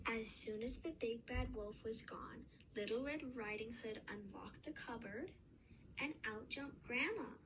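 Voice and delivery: usual speaking voice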